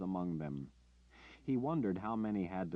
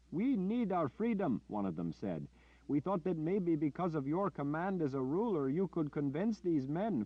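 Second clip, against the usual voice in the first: high, pleading voice; hope for a bloodless rescue; earnest villager; 10 semitones higher, 4 dB louder than usual. Acted. Villager explains their hope.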